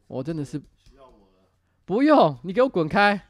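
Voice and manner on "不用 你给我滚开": shooing away. Real, not acted.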